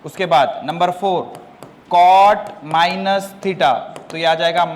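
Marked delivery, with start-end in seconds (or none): 1.9-3.7 very slow, stretched words; slow dictation. Shown as a demonstration. Teacher giving the fourth formula, slow dictation. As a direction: give the formula at writing speed.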